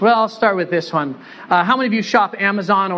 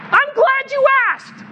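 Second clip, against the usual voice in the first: much higher mock coach voice; coach's voice. Coach answering player's question, high mock impression. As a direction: do the coach's odd voice again. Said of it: "he actually talked like this".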